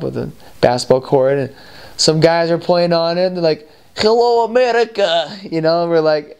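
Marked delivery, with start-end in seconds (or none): 4.0-5.4 put-on high, lightly accented Russian greeting; mock Russian accent. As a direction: call out like a Russian local, higher up. Russian players calling out.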